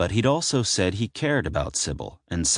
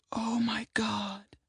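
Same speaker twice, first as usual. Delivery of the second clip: slow breathless whisper, voice high and airy; 8 dB quieter, slower than usual. Acted. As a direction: gasp it out in shock, barely voiced. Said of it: "Sybil whispered".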